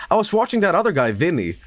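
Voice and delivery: quick, lightly voiced girl; friend voice. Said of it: "She's like".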